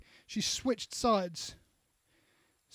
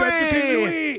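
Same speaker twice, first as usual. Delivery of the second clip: louder, higher battle cry; mock revolutionary shout; 6 semitones higher, 8 dB louder than usual. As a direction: shout it back like the crowd. Echoing the slogan.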